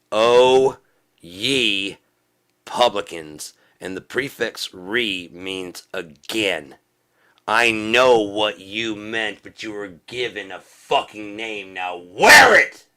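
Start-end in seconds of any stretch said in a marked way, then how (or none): 0.1-3.5 loud, high cry on OH, easing down; booming, drawn-out denunciation; shouted rebuke. Real, not acted. Crying out a scriptural rebuke. 7.5-12.9 slow, loud, high-pitched rebuke; real yelling fury, drawn out; angry shout. Real, not acted. Angrily rebuking the Republicans.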